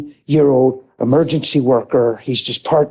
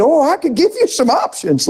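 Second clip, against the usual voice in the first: much higher, teasing policeman quip; wry policeman; 11 semitones higher than usual. Retold or imitated. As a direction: hand him a dry joke, pitched up. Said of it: "He said".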